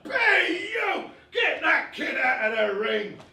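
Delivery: high, loud yelled order; ringside shout. Acted. Someone at ringside orders the kid out, shouting. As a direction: holler it across the boxing ring.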